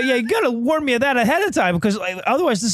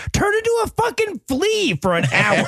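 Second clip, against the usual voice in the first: put-on kid screaming; 10 semitones higher than usual. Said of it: "Noah screaming".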